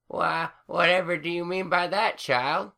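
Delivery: put-on crackly old grandma, dismissive; old granny voice. A narrator's reading.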